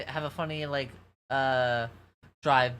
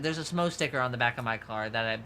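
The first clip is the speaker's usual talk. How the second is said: usual speaking voice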